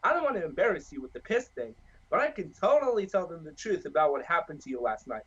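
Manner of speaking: ordinary talk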